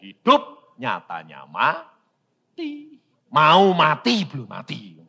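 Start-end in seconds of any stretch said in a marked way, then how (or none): none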